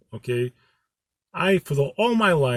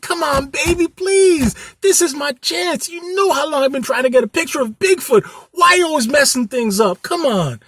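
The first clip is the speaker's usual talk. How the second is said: much higher, louder mock whining plea; high, hammed-up whining to his girlfriend; whiny pleading; 10 semitones higher, 4 dB louder than usual. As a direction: beg her like a kid denied a toy. Xavier begging his girlfriend.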